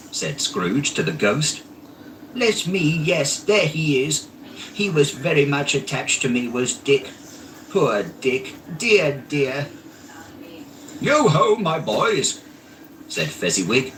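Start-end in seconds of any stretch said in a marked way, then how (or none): 2.3-9.7 high, tender reminiscing; lightly voiced fond old man; fond remembering. A narrator's reading. Scrooge recalling dear old friend. 11.0-12.4 high, loud hearty call; jovial boss. A narrator's reading. Fezziwig calls his apprentices.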